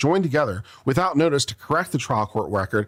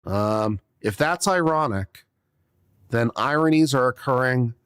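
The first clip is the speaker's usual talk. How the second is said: normal talking voice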